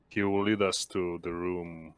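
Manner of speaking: ordinary talk, nothing marked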